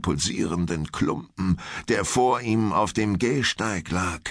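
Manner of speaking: ordinary delivery, nothing marked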